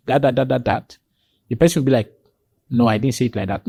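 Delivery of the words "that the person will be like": ordinary delivery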